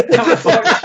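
high squeaky laugh through words; giggling out the number. Real, not acted. Laughing through list item.

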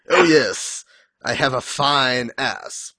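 slow, self-satisfied boast in a put-on voice; put-on voice, slowly preening; smug slow Meliodas voice. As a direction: savor each word like a vain hero.

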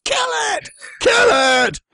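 high, loud cartoon battle cry; hammed-up mob shout. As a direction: yell it like a comic angry mob. Simpsons mob shouts again.

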